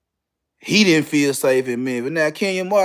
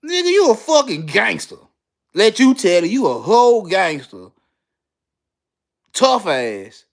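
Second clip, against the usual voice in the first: incredulous call-out; 4 semitones higher than usual. Real, not acted. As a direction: call him out like it's absurd. Calling out Kenyon's claimed fear.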